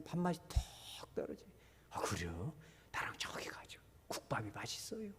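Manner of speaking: hushed whisper. Retold or imitated. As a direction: confide it in a whisper. Church members whispering about meal. (소곤소곤 속삭이며)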